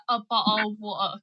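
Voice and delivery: "bottle of water" with the t's dropped, Cockney-style; dropped letters. Shown as a demonstration. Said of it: "often would miss out letters".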